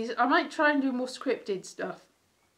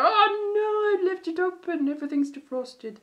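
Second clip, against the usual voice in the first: mock dismay; 8 semitones higher than usual. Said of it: "they go".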